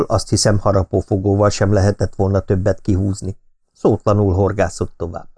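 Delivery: ordinary delivery, nothing marked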